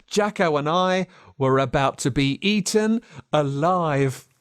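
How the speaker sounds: ordinary delivery, nothing marked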